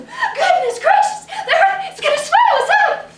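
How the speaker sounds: very high, loud panicked cry; shocked shriek. Acted. Woman dropping her shopping, shrieking in panic. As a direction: squeal it out in shock. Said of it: "she's go".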